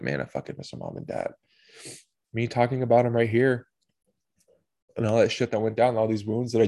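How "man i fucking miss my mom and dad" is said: much lower and softer; quietly voicing an ache for mom and dad; low, quiet longing. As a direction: drop into it like a private thought. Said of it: "Sometimes it's more like".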